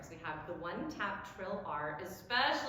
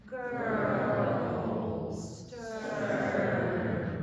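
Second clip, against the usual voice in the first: put-on "Girl" and "stern" stretched slowly and louder; slow-motion girl stern; 8 dB louder than usual. Shown as a demonstration. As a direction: drag the tongue through each word. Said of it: "say it slowly to yourself".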